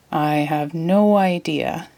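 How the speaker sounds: said slowly. Acted. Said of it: "he said slowly".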